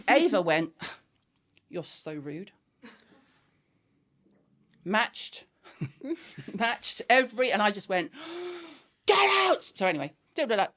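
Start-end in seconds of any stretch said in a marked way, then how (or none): 1.7-2.5 low, soft, affronted reply; hushed affront. Retold or imitated. Daughter answering mum's swearing, low hushed affront. 8.2-8.7 high, sharp intake of breath; big gasp. Retold or imitated. Mum about to explode at daughter, big gasp. Acts huge breath before yelling. 9.1-9.6 high, loud retold yell; furious shout. Mum throwing her daughter out, furious shout. Acts mum's explosion at daughter.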